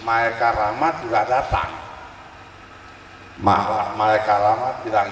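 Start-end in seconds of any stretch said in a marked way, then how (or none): none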